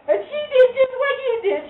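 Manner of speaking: usual speaking voice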